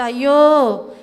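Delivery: loud mock lament, crying out; retold cry of grief; wailing ayyo. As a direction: wail it like mourners beating their chests. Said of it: "అంటున్నారంట".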